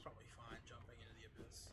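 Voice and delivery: fast hushed whisper; whispered quick aside. Real, not acted. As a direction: murmur it under your breath. Muttered aside while playing.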